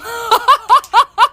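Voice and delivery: high loud laugh; burst of laughter. Real, not acted. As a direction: let out a big laugh. Laugh after punchline.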